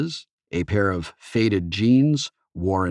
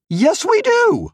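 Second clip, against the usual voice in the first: much higher, blurted defensive yes; quick eager reply; 6 dB louder, slower than usual. A narrator's reading. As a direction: blurt it out fast. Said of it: "Og replied immediately".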